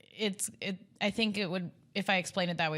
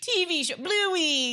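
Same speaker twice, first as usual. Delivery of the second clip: loud; 11 semitones higher, 5 dB louder, slower than usual. Real, not acted. Annoyed about kids' show.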